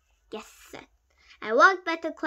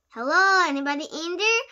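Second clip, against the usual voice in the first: raised, louder knock-knock call; mocking call; 5 semitones higher, 8 dB louder than usual. A narrator's reading. Friend knocking on his head, mocking call. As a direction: tease as if calling into an empty head.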